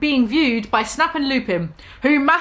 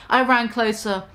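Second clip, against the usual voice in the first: normal talking voice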